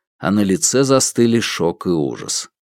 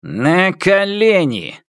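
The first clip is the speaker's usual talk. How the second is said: barked command; 8 semitones higher, much slower than usual. Acted. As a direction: snap the order down at him. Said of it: "рявкнул Лу Цзиньтан".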